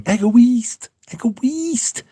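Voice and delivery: high mock shouted jingle, fading away; imitating girls shouting. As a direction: squeal the advert slogan like the girls. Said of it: "the girls are just shouting".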